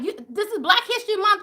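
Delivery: normal talking voice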